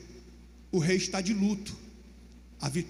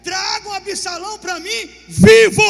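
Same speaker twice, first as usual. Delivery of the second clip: shouted royal command, slow and much higher; furious shout; 9 semitones higher, 16 dB louder, slower than usual. Acted. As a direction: bellow the order across the camp. Rei ordena aos comandantes. (ordem gritada com fúria)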